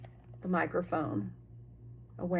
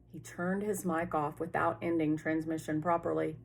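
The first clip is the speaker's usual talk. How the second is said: usual speaking voice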